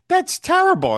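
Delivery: high-pitched mock dismay; appalled retort. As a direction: quote her as thoroughly scandalised. Acted retort of shocked Margaret.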